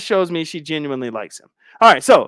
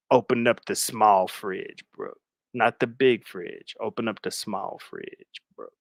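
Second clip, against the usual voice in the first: creepy stalker; 8 semitones lower, 7 dB quieter than usual. Acted. Captor directing his guest to the fridge, low and creepy. Envy as creepy host giving orders.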